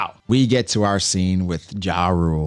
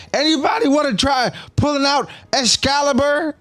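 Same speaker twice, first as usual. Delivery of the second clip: put-on high, goofy drunk invitation. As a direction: show off like a drunk fool. Drunk Usopp offers the blade.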